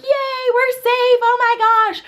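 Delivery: very high mock squeal; old-school cheer. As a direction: squeal it like a teen back then. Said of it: "but back then it was like".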